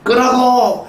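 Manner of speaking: put-on a full-force high yell at the kids; loud shout. Father yelling at his kids to turn it off, loud shout. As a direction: bark the order at full volume, really loud. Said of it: "큰 소리로".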